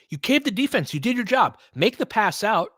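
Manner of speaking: normal talking voice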